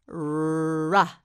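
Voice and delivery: "r" as a long held rrr-uh, not the letter's name; isolated R sound. Shown as a demonstration. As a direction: hold the sound of "r", releasing into uh. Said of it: "The letter R makes the sound".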